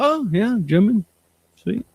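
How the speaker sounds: high, goofy impressed voice; dopey high voice. Retold or imitated. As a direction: play a gullible fan. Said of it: "people will be like".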